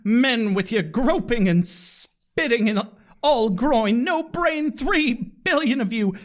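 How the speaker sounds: high-pitched, hammed-up theatrical tirade; dramatic Buffy voice. Chicago-style showgirl berating men in a bad play, melodramatic. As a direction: declaim it grandly like a stage diva. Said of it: "do the dramatic reading Buffy voice".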